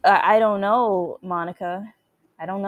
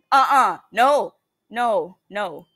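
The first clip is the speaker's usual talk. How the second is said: usual speaking voice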